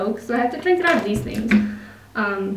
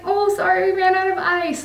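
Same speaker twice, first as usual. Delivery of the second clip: higher, lightly voiced apologetic cashier; a cashier sorrily breaking bad news, voiced a bit higher; 9 semitones higher than usual. Cashier apologizing to a customer, higher apologetic voice. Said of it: "And she's like".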